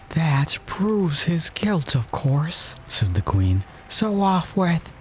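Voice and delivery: high slow imperious queen; drawn-out royal verdict; Queen, haughty. A narrator's reading.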